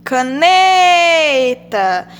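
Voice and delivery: Caneta very slow, long loud "ne"; stress on NE in Caneta. Shown as a demonstration. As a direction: hold and punch the middle syllable of Caneta. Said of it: "Vamos ver qual a sílaba tônica". (Caneta com o "ne" bem forte e prolongado)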